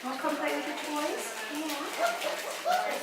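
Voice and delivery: high, sing-song coaxing voice for puppies; puppy talk. Real, not acted. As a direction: coax the puppies over, sweet and high. Calling puppies to come play.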